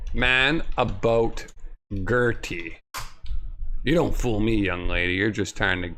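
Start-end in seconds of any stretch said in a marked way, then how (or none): 3.8-5.9 a hint of a crusty old man; a touch of a cranky old man; lightly voiced old man. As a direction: slightly lower grumbling old coot.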